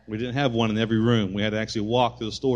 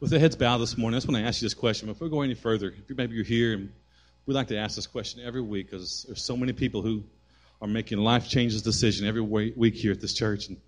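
altar call; 2 semitones lower than usual. Acted. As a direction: invite gently with heads bowed.

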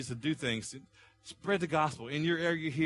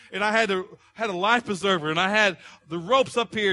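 preaching at full force; 5 semitones higher, 9 dB louder than usual. Acted. Pastor urging the congregation, preaching at full force. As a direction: preach it hard to the room.

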